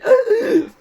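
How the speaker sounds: sharp high gasp of alarm, imitated; mock gasp. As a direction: gasp as if you just dropped something. Mimics clumsy YouTuber breaking things. (petit cri de surprise imité, aigu)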